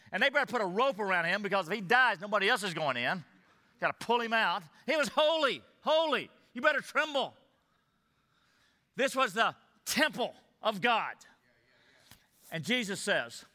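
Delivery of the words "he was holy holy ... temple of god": higher, pressing awe on holy; a touch of trembling reverence; awed emphasis. Acted. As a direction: make them feel the holiness. Preacher stresses God's holiness.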